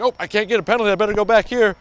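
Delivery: excited rush. Retold or imitated. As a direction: caught up in the celebration. Retold excited sideline thoughts.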